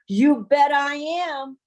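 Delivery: slow, loud, choked-up yes; triumphant, tearful. Real, not acted. Telling friend she's going, slow, loud and choked up. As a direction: savor it, nearly crying with joy.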